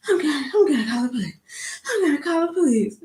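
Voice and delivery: panting, pitched high, gasping the threat; breathless high whiny imitation of an angry lady; out of breath. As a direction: wheeze it out like she just ran. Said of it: "'cause she's out of breath".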